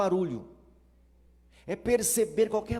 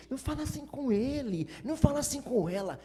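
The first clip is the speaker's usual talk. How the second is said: high, fast mocking plea; paródia de pai superprotetor, voz aguda e rápida; mocking overprotective parent; 7 semitones higher, faster than usual. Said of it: "Psicologia barata".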